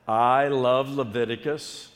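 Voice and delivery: slow, measured congregation prompt; half-enthused catchphrase. Shown as a demonstration. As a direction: say it slowly, not yet fired up. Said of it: "you're not saying that with the enthusiasm".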